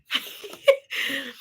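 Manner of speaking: soft burst of giggles; real giggling after a tease; laughter. Real, not acted. Joking that a viewer is a cannibal, giggling.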